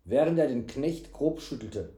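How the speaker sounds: plain reading voice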